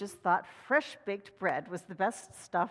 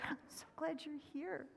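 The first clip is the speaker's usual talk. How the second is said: much softer, higher welcome; tender welcome; 4 semitones higher, 15 dB quieter than usual. Real, not acted. Minister welcoming children, soft and tender. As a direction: greet them gently, almost hushed.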